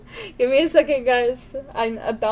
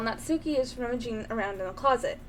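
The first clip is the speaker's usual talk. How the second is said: ordinary delivery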